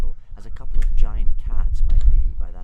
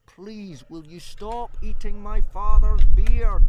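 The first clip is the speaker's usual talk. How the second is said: high, slow yell, swelling louder; yelling his loudest; 12 semitones higher, 6 dB louder, slower than usual. A narrator's reading. Hiccup to dragon eating father's beard, straining yell. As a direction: strain upward into a polite yell. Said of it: "Hiccup yelled as loudly as he could".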